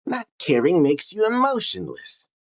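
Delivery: high-pitched taunting caricature; exaggerated high complaint in an abuser's role; mock would-be abuser. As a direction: put on a high, needling voice. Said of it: "a would-be abuser in action".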